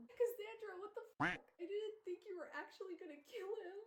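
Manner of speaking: high, loud disbelieving protest; indignant, voice shot way up; mock outrage. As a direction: scold the author in a high-pitched voice. Mock scolding the absent author.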